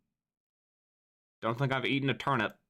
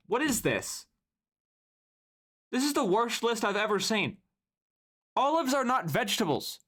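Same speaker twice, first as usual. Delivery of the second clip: getting mad; 10 semitones higher than usual. Real, not acted. Streamer getting mad at the tier list, raising his voice. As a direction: fume at the list, exasperated. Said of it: "I'm getting mad".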